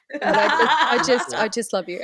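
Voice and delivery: giggling through the words; happy teary laughter; laughing. Real, not acted. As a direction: laugh it out while saying love you. Laughing through loving thanks.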